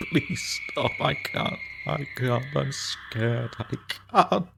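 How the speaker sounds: rising toward a scream of fear; panicked high plea. Acted. Man breaking down before ghost.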